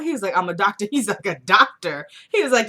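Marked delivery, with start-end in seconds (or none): 1.3-2.1 louder, incredulous echo; mock disbelief. Rusty doubting Randall is a doctor, mock disbelief. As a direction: throw DOCTOR back as a scoffing question.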